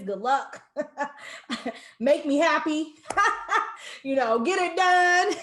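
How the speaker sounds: giggling through a mock bossy handoff; laughing. Retelling handing off the project.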